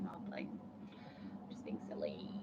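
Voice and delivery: unvoiced whisper, playful. Playfully whispering to a viewer asking if she's mad. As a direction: breathe it out as a teasing whisper. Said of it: "I was like".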